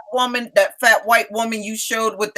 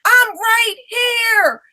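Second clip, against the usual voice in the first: put-on high, drawn-out holler of a small boy; high-pitched kid yelling across the store; imitating yelling child; 12 semitones higher, slower than usual. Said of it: "that's what he yelled out too".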